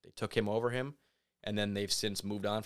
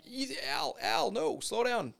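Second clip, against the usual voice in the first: much higher pleading voice, hammed-up; mock alarmed plea; slower than usual. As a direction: beg Al to calm down in a high squeak. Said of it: "and you're like".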